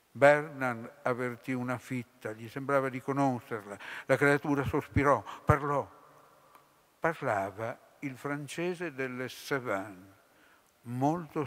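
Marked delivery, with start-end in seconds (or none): none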